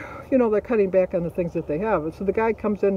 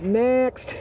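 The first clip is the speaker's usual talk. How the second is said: higher-pitched, drawn-out call for the next customer; 6 semitones higher than usual. Retold or imitated. Christy brushing off a difficult customer for the next in line, dismissive call.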